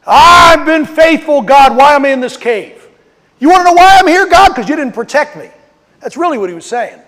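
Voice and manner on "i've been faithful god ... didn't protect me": Elijah screaming at God. Retold or imitated. Preacher voicing Elijah crying out to God, loud. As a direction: shout your complaint up at God. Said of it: "His flesh began to scream out".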